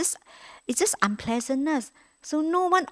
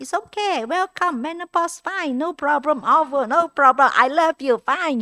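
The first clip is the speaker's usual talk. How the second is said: higher, lightly sing-song welcome to her own discomfort; kind self-talk; 6 semitones higher, 4 dB louder than usual. Pretend. Nun talking kindly to her own menopause, mildly cheerful and higher. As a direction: greet the discomfort like a friend, higher than usual.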